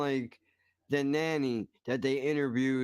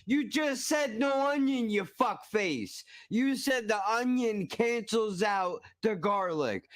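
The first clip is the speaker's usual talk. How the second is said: high, drawn-out accusing rant; mock outrage; 8 semitones higher than usual. Ranting at the video cook who said no onion, mock outrage. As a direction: scold him like he lied to you.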